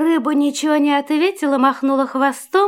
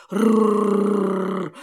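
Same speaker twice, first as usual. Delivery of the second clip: low dog growl; 6 semitones lower, 3 dB quieter than usual. A narrator's reading. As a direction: growl like a big shaggy dog. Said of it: "зарычал пёс".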